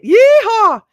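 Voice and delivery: loud, high cowboy whoop; yeehaw shout. Shown as a demonstration. Livestream host showing viewers her call to action, whooping. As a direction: holler it like a cowboy. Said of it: "And you have to say it".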